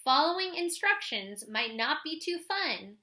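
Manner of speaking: put-on clear, lively read-aloud voice for kids; reading a picture book aloud. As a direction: read it like a story for the class. Reads a children's book aloud.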